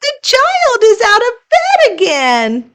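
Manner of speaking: very high eager chirp; red monster answering eagerly; chirpy monster. Acted. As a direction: chirp it like a bright little monster. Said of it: "chirped the red monster".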